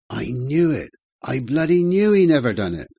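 high, loud, swooping woman's cry; vindicated outburst. A narrator's reading. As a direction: burst out in triumphant vindication. Woman learns he is proved innocent.

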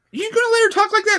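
high, fast, shrill-sounding imitation; squeaky rushed outrage; hammed-up nagging wife; mocking ex-wife. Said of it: "my ex-wife's like".

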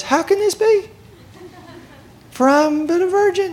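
put-on very high teenage girl voice; teenage Mary. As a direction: squeal the question like a bewildered young girl. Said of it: "there's this little teenage girl".

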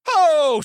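very high, very loud "oh"; explosive whoop out of nowhere; imitated tic whoop. Imitating a TV contestant's tic, sudden whoop. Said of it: "she does it afterwards".

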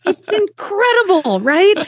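high, slow drawn-out "incredible" rising to "right"; amazed emphasis. Shown as a demonstration. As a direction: stretch out the amazement. Repeats earlier line, drawn out high.

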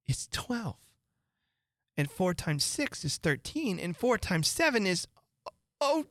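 put-on girlish worried musing; a little girl anxiously checking what she knows; rising girlish fluster; fretful young Alice. Alice tests herself, fretting.

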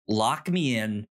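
ordinary delivery

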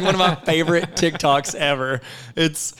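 usual speaking voice

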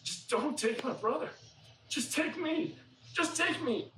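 high-pitched, urgent plea; earnest pleading. Retold or imitated. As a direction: beg to be taken instead. Judah begs to replace his brother.

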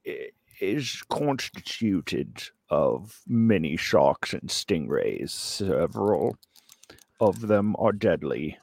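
ordinary delivery

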